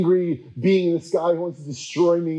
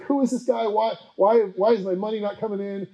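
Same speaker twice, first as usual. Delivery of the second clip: high, mock-indignant whine; exasperated pope; 6 semitones higher than usual. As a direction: whine like a pope losing money. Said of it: "The Pope's like".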